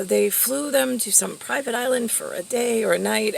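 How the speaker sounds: normal talking voice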